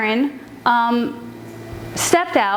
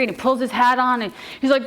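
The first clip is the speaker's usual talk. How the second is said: ordinary delivery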